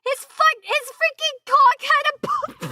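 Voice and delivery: very high, loud disbelief; high-pitched, incredulous outburst; shocked outburst. Real, not acted. Shocked realization watching a clip.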